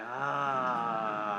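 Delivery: an audible, relaxed breath-out sigh; guided sigh. Shown as a demonstration. Leading the room through breathing, audible sigh. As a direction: sigh it out with the group. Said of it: "let it out with a sigh".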